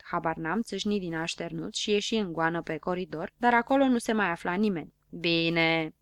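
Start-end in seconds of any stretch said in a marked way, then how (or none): none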